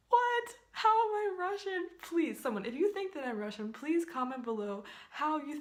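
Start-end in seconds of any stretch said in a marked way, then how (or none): 0.1-1.9 high, loud outburst of disbelief; real disbelief. Real, not acted. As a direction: blurt it out, genuinely baffled. Real reaction to being called Russian.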